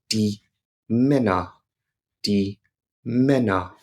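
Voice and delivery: "Männer" with a short, clipped "ä" like English "men"; short German E. Shown as a demonstration. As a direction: hit the "ä" in "Männer" only briefly. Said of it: "the E is short".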